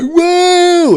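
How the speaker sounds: high loud amazed whoa; hammed-up amazed host; intense Greg Wallace. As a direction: gasp at the machine like a manic host. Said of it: "he's like".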